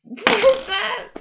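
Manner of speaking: high, loud, startled question; surprised. Real, not acted. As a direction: blurt it out in disbelief. Reacting to friend's silly bit.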